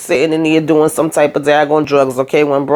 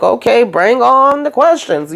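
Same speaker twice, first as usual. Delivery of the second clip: cheerful quote; 6 semitones higher, slower than usual. Retold or imitated. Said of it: "And he's like".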